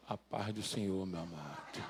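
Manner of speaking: very low, soft, solemn greeting; pious altar voice. Retold or imitated. Preacher imitating someone who steps up to the altar, pious altar voice. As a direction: sink into deep, hushed mock reverence. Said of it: "Aí quando sobe no altar".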